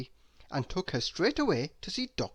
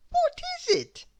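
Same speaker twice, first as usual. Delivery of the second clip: very high, wondering question; puzzled and amazed; 4 dB louder than usual. A narrator's reading. Miss Giggles looks at the box, amazed.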